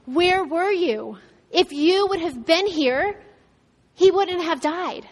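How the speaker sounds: acted plea, slow and pressing on each word; reproachful grief. Sisters confronting Jesus after Lazarus died, reproachful.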